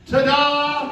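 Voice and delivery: gleeful mock fanfare; little boy's ta-da. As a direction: imitate a proud toddler magician. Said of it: "And he went".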